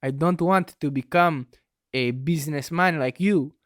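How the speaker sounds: hammed-up exotic European accent, earnest son; lightly put-on European accent pleading with father; mock heavy accent. Son tells his father he wants to paint, in a put-on European accent. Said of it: "Everyone else is like".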